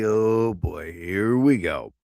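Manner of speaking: put-on oh boy, drag it out low like you saw it coming; weary sigh. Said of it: "It's like".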